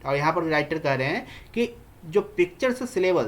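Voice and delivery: usual speaking voice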